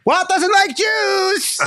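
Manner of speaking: very high, slow whining voice; whiny tattletale. Retold or imitated. Fired animator tattling to everyone, very high whine. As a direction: whine it like a sore loser spreading gossip.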